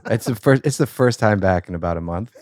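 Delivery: laughing through the words. Real, not acted. Embarrassed after cigar mishap.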